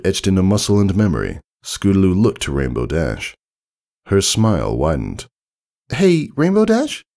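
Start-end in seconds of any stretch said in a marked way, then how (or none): 5.9-7.0 put-on high, eager kid voice calling her hero; young filly. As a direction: ask her idol shyly but eagerly. Scootaloo voiced in story.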